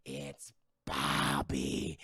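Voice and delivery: very slow, very low reveal; mock-ominous villain reveal; dramatic reveal. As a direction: draw it out deep and ominous.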